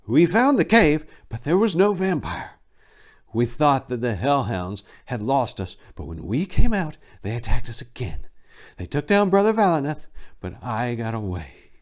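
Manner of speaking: high, strained voice of a shaken monk; distraught monk. Acted. Silenus recounting the hellhound attack to Tor, strained and high. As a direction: tell it like he barely survived.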